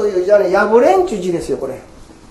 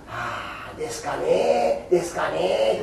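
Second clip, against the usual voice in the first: soft, slow, hesitant mumble; imitating a bashful old lady; shy hesitation; 5 semitones lower, 7 dB quieter, slower than usual. Old woman hesitates when asked to chant, mumbling. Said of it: "もじもじしたから".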